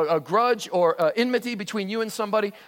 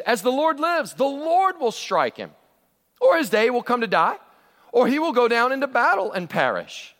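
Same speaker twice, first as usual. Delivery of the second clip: higher, louder, solemn vow of trust; David's vow; 6 semitones higher, 4 dB louder than usual. Retold or imitated. Preacher voicing David's vow to Abishai, raised and solemn. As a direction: proclaim it like David swearing by the Lord. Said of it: "And David said".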